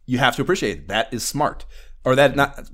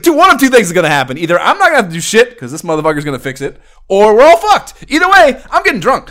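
put-on higher, louder, fast rambling drunk dad; getting drunker; 5 semitones higher, 4 dB louder, faster than usual. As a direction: rattle it off like a tipsy dad. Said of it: "I wanna roleplay the shit out of that".

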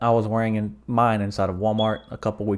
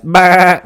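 very loud, high parting bleat; one last blaring "baa"; mocking sheep bleat; 8 semitones higher, 14 dB louder than usual. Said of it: "he does one last".

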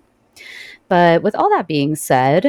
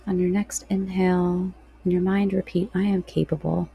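guided meditation. Real, not acted.

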